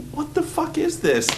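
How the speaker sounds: high, squeaky, incredulous; baffled outburst. Retold or imitated. Moviegoers reacting to the ending, high incredulous squeal. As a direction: ask it in high-pitched disbelief.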